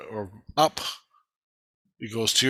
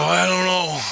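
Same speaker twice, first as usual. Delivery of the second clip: loud, higher, exasperated "I don't know."; retold angry outburst; 7 semitones higher, 6 dB louder than usual. Woken man answering his kids, loud and exasperated. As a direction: snap it back at the kids, fed up. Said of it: "So I went pissed".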